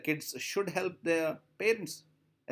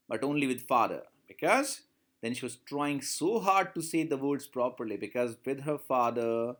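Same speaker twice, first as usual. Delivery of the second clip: normal talking voice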